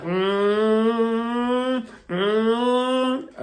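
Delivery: long, slow, high hummed engine drone; imitated engine hum. Showing viewers how the bike sounded, a slow hum. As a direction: hum the small bike straining. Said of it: "I was like".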